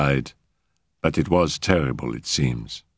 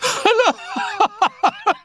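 high, wild peals of laughter; pealing laughter. Acted. Annette laughs at the kneeling maid, high and wild. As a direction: laugh on and on in triumph. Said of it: "Peal upon peal of laughter".

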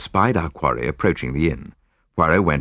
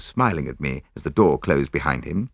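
plain reading voice

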